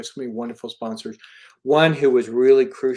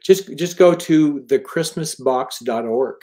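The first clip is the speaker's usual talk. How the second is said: slow emphatic web address; 3 semitones higher, 8 dB louder, slower than usual. Real, not acted. Spelling out the donation web address.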